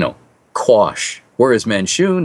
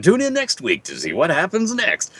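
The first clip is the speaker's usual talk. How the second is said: high, lilting show host; TV host sign-off; 8 semitones higher than usual. Retold or imitated. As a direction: sign off like an old TV narrator. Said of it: "standing there and say".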